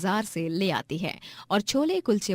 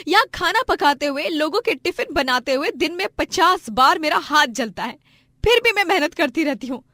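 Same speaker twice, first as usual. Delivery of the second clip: high, loud indignant wife; angry scolding; 8 semitones higher, 6 dB louder than usual. Acted. Wife refuses drunken husband her earnings, scolding angrily. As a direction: tell him off with raised voice.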